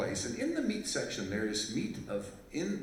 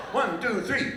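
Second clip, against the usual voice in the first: count-in call; 11 semitones higher, 9 dB louder, slower than usual. Real, not acted. Counting in the crowd's shout.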